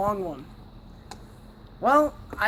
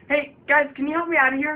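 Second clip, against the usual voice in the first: loud, higher-pitched cry for help; calling for help; 4 semitones higher, 8 dB louder, faster than usual. Acted. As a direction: call out urgently to be let out.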